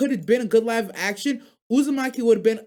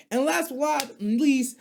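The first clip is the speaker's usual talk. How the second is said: ordinary talk, nothing marked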